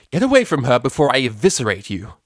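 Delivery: loud, high-pitched threat; forceful shout. A narrator's reading. Mare threatening colts to protect her friend, forceful shout. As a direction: shout to scare them off. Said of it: "never shouted so loudly and forcefully".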